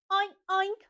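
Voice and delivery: high, playful pig oinks; two short high oinks; imitación de cerdo. Shown as a demonstration. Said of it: "El cerdo hace oink". (oinks agudos de cerdito)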